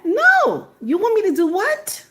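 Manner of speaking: high-pitched mock outrage; incredulous refusal. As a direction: refuse in squeaky-high disbelief. Balks at imagined roof job.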